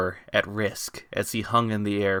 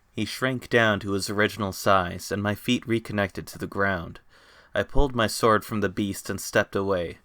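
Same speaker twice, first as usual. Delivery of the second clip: ordinary delivery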